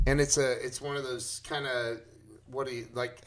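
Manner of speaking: ordinary talk, nothing marked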